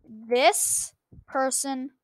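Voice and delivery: ordinary delivery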